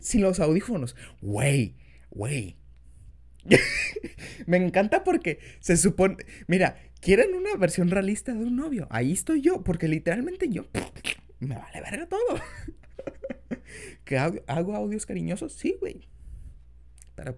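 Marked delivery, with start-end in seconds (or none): 3.4-4.4 squeaky laugh burst; sudden high laugh. Real, not acted. Laughs at own remark. 12.2-14.0 breathy high laugh; soft unvoiced giggle; airy laugh. Real, not acted. Laughs after swearing.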